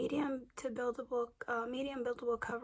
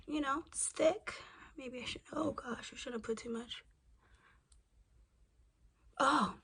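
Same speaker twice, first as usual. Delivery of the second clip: ordinary delivery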